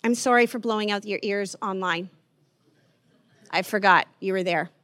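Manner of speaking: ordinary talk, nothing marked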